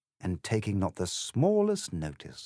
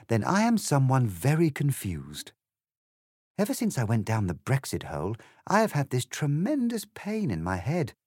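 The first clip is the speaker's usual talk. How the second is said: put-on higher-pitched, troubled young heroine; confused Alice; 6 semitones higher than usual. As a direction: pour out your muddle, voice raised in pitch.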